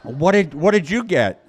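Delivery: high, loud, forced cheer with a laugh; hammed-up fake delight; fake enthusiasm. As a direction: pretend to share their excitement. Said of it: "I'm pretending I do".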